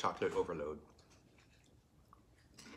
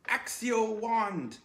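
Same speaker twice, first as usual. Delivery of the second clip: much higher, louder, drawn out very slowly; hammed-up spell incantation; spell casting; 12 semitones higher, 7 dB louder, much slower than usual. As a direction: summon it like a grand wizard. Casting a spell to summon wand.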